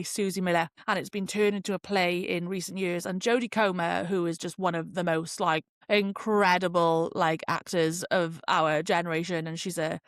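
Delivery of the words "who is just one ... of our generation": usual speaking voice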